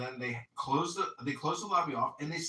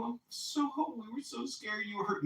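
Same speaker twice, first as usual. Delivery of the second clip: higher, softer fretting; mock worry; 8 semitones higher, 5 dB quieter than usual. Group telling Sarah they were scared, mock worry. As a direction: overdo the relief, lightly. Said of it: "everybody's like".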